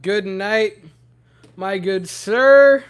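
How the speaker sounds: usual speaking voice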